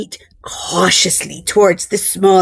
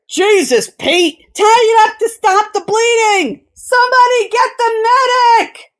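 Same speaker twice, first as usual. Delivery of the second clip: alarmed shouting; 11 semitones higher than usual. A narrator's reading. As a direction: shout for help in a panic. Crewmen panic at the bleeding.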